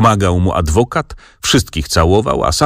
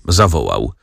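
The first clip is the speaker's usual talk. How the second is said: plain reading voice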